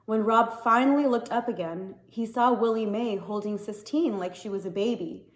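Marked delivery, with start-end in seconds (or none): none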